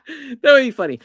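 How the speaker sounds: laughing. Real, not acted. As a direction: giggle it out. Laughing at own joke.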